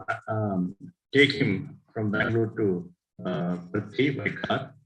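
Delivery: normal talking voice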